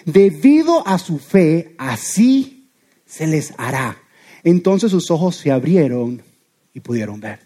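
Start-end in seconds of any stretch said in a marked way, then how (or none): 0.0-4.0 slow, measured, solemn words of Jesus; Jesus speaking. Retold or imitated. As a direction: give each word weight, slowly, as a blessing. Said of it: "y dijo".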